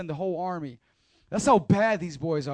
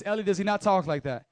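ordinary talk

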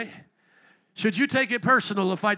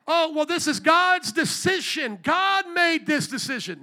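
mock quote; 7 semitones higher, 4 dB louder, slower than usual. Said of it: "people say".

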